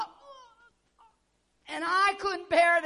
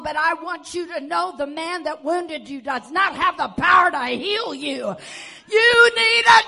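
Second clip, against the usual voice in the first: building exhortation; 9 dB louder than usual. Retold or imitated. Preacher retells his counsel, building.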